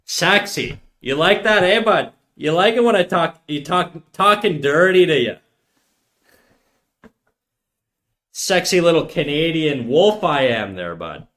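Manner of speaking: put-on high, loud trailer-trash Canadian accent. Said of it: "a trailer trash way of talking".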